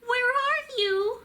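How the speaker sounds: put-on high tiny squeak of a lost baby bat. A narrator's reading. Lost baby bat squeaking for her mother, frightened. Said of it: "Stellaluna squeaked".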